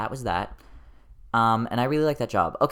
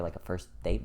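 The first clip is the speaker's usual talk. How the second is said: ordinary delivery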